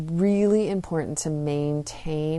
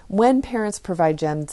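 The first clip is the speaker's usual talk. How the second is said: ordinary talk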